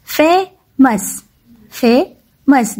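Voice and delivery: Famous slowly broken as "fe - mas"; long gap between "fe" and "mas" in famous; split into two syllables. Shown as a demonstration. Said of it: "इनको टू parts में पढ़ते".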